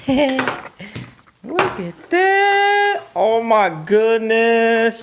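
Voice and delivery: high, slow, gushing wonder; delighted opening a present. Real, not acted. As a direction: coo over the gift. Parent gushing over toy.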